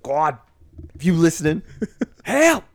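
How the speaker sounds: high, loud comic movie voice; Chappelle impression. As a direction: squeak it out in an over-the-top stoner voice. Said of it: "I love Chappelle".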